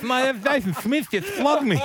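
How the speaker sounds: high, slow and laughing, as if his tongue is bitten; telling the story of the footballer's bitten tongue, mock mumble through a laugh; imitating a bitten tongue. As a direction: mimic a mate talking with a cut tongue, laughing. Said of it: "he's talking like that".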